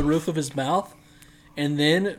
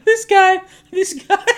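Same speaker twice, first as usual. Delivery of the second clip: high, loud, laughing disbelief; excited; 10 dB louder, slower than usual. Real, not acted. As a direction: burst out amazed and laughing.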